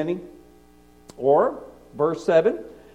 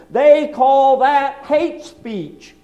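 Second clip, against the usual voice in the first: slow, loud, outraged; indignant slow shout; 8 semitones higher, 7 dB louder, much slower than usual. Acted. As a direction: drag it out loud with scorn. Preacher mocking opponents.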